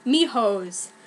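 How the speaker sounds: ordinary talk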